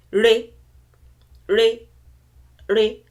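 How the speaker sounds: "re," said loud, each one level and separate; mid tone drill. Shown as a demonstration. Teacher drilling the "re," tone for learners, loud and level. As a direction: repeat "re," three times, loud and level. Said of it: "and then".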